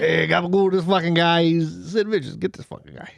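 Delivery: bad New York Italian cop accent; a clumsy Italian-American cop voice; hammed-up New York Italian accent; failed Italian accent. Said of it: "I can't do an Italian accent".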